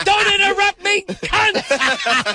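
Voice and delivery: screamed, high and loud; furious shout. Retold or imitated. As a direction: blow up out of nowhere. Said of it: "It was so like".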